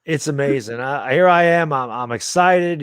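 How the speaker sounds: normal talking voice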